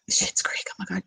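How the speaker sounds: soft secretive aside; hushed whisper. Real, not acted. Host whispers a viewer's favourite show title.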